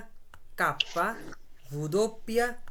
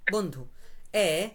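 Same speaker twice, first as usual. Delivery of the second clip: ordinary talk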